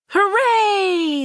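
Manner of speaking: high, loud joyful cheer. A narrator's reading. Townspeople celebrating the bugs gone, loud cheer. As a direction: shout it like a happy crowd. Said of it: "the people of Hamlin cheered".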